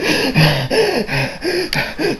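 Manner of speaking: high, quiet puffing, acted out; out-of-breath panting. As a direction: pant as if winded from chasing a bus. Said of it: "Josuke is very out of breath".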